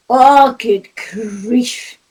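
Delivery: normal talking voice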